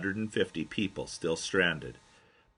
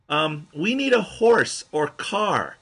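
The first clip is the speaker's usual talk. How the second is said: higher, slow, spelled-out request; slow, deliberate foreigner speech; 8 semitones higher, 6 dB louder, slower than usual. Retold or imitated. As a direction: say it slowly so he gets it. Asking rider for transport.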